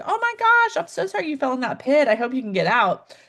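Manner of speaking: sing-song mock pity; showy sympathy. Shown as a demonstration. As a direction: coo sorry from above, keeping your distance. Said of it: "That's sympathy".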